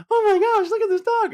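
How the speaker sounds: very high, loud gushing falsetto. Shown as a demonstration. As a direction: squeal sweetly at a dog in falsetto. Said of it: "do your [name] voice".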